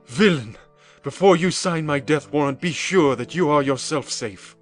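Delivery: high, loud threatening shout; furious exclamation. A narrator's reading. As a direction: lunge at him with the words.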